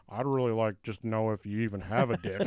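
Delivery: slightly low, deadpan imitation of a guard; understated taciturn guard. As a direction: quote him evenly, barely any emotion. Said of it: "the guard with me who never, ever talked".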